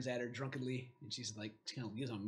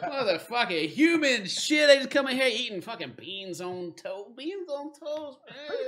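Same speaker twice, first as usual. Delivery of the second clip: dwarf rant rising to a high whine; disgusted dwarf; 11 semitones higher, 11 dB louder, much slower than usual. Acted. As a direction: grumble at the human's food, drawn out. Dwarf grumbles about human food.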